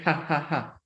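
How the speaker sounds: ha, ha, ha said with the Spanish j, like ja ja ja; a throaty jota on each "ha"; Spanish laugh. Shown as a demonstration. Teacher repeating the Spanish laugh for students, throaty. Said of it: "the laugh in Spanish ... with the J sound".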